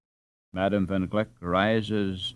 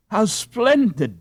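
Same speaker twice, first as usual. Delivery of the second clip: high, loud, slow cry of joy; delighted cry; 10 semitones higher, 6 dB louder, slower than usual. Acted. Gretchen crying out over her silver skates, high and loud. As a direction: gasp out your delight at the prize. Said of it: "she cries".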